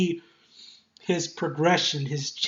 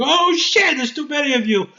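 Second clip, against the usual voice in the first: mock panic; 9 semitones higher, 5 dB louder than usual. Panics as enemies swarm.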